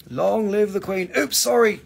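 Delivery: high, loud British proclamation; hammed-up British accent, pitched high; mock British accent. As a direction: hail royalty in a put-on British accent. Returns to mock British royal accent.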